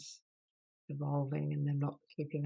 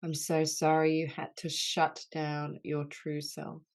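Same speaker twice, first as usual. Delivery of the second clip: healing litany; 4 dB louder than usual. Acted. Leader voicing apologies to the wounded inner child, earnest and grave. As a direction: lead the group through each sorry with tender gravity.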